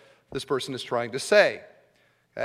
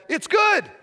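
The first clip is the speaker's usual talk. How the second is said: "It's good" popped up much higher; high bright plain version; 5 dB louder than usual. Retold or imitated. Pastor contrasting plain praise for his listeners, high and light. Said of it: "You could have said".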